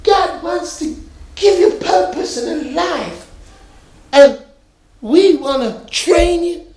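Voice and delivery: high, slow imitated Nation of Islam recruiter accent; put-on accent. Recruiter coaxing a young man, in an imitated accent. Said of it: "you're gonna have to forgive the accent".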